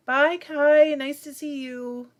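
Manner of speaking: high, loud farewell; calling out goodbye. Real, not acted. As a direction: call the goodbye out cheerfully.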